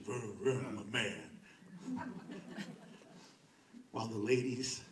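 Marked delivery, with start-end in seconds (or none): 0.0-2.1 slow, low, laughing he-man boast; mock macho. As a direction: ham up the tough guy revving his engine. Mocking men revving their cars.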